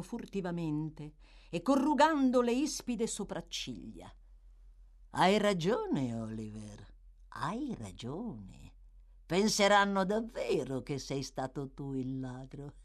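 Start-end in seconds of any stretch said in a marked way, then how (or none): 5.1-12.7 slow, drawn-out agreement; hint of a gloating villain; lightly voiced sly schemer. A narrator's reading. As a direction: draw it out with mild mockery.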